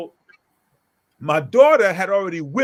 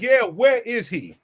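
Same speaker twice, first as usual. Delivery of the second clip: confrontational challenge; 6 semitones higher, 4 dB louder than usual. Retold or imitated. Father challenging the upset mother, confrontational demand. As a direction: call their bluff, higher and louder.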